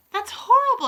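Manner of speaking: high, loud outburst; indignant cry. A narrator's reading. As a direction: cry out in protest. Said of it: "I cried".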